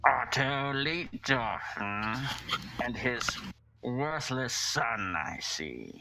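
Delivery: menacing voice muffled behind a mask. Acted. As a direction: speak as a cult leader behind a mask. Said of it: "a muffled voice rings out".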